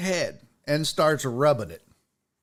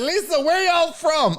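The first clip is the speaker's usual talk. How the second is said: high, loud boozy question; drunk guy voice; 10 semitones higher, 4 dB louder than usual. Retold or imitated. Drunk guest asks Lisa again where they're from, high voiced. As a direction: repeat it like a drunk who forgot.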